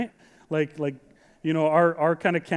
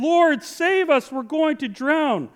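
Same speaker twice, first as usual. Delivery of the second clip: high, raised cry for help, slowed for effect; shouted plea; 8 semitones higher, 4 dB louder, slower than usual. A narrator's reading. Disciples waking Jesus in the storm, read as a shouted plea. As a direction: call out the plea as a dramatic reading.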